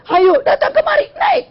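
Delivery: high-pitched urgent call. Acted. Noah calls out to son.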